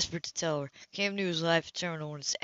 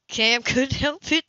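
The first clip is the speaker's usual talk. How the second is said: laughing; 8 semitones higher, 7 dB louder, slower than usual. A narrator's reading. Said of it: "The laugh just popped up".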